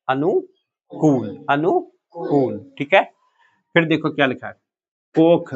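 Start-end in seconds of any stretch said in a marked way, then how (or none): 0.1-2.7 अनुकूल very slowly in two parts: "anu... kooool"; long vowel. Shown as a demonstration. Teacher drilling the class on the spelling, अनुकूल split with long ऊ. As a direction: draw out the कूल of अनुकूल. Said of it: "बड़े ऊ की मात्रा में बदल जाएगा".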